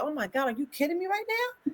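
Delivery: high, startled gasp of disbelief; mock disbelief. Mother seeing her sons pictured, mock disbelief. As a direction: act shocked at the photo. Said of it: "I said".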